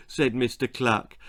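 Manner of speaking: ordinary delivery, nothing marked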